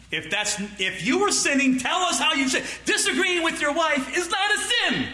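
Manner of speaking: high, impassioned preaching shout; forceful exhortation. Real, not acted. Preacher urging church to name sin truly, forceful exhortation.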